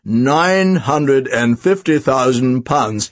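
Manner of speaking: low, slow auctioneer's call; auctioneer announcing. A narrator's reading. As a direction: announce the bid slowly, stretching each figure. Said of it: "he announced".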